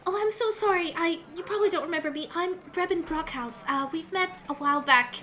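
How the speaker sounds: high, bright bubbly woman voice; high chirpy halfling; bubbly halfling cleric. Acted. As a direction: play her as a cheerful healer. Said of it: "She goes".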